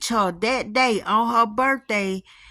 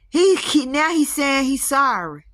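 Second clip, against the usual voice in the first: exasperated; 5 semitones higher than usual. Real, not acted. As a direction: splutter it out, half laughing in disbelief. Exasperated outburst about the ghost.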